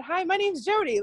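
high, chirpy professional introduction; nurse mode. Retold or imitated. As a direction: greet a patient like a nurse. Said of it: "complete nurse mode".

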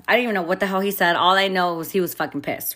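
ordinary talk

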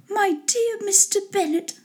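high-pitched wife character voice; put-on high lady's voice; Mr. Bennet's wife. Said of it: "said his lady to him".

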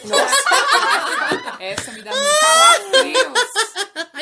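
high, slow, screechy witch voice; witch cackle voice. Pretend. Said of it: "quer fazer a risada de bruxa a noite inteira".

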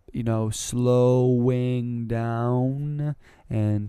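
very slow, drawn-out speech-therapy demo. Shown as a demonstration. Demonstrating the slowed-down speech taught in speech therapy, very slow. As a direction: stretch every word out slowly. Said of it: "talking like this".

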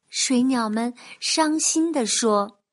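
ordinary delivery

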